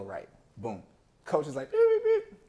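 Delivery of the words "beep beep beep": very high, loud mimicked whistle beeps. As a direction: beep like a coach's whistle cutting the play. Coach whistling to stop play.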